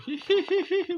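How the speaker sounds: loud, high-pitched giggles; sudden nervous burst of giggling. Real, not acted. Player after a scary moment in game, giggling. Laughs at a scary near miss.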